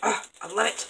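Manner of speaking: usual speaking voice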